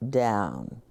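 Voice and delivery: ordinary delivery, nothing marked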